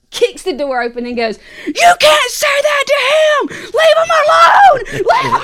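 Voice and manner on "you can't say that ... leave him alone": high, loud screamed imitation; retold screaming. Retelling Dad bursting in, screaming. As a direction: scream it out in mock outrage. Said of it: "just starts screaming at me".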